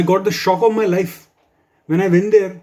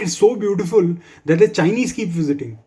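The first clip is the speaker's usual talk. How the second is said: ordinary talk, nothing marked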